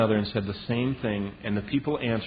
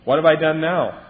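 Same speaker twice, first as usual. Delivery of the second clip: louder, protesting, fed-up whine; exasperated youngest sibling; 4 semitones higher, 8 dB louder than usual. Retold or imitated. Youngest child protesting to bossy siblings, loud exasperation. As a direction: throw it back at them, indignant. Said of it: "I was the youngest".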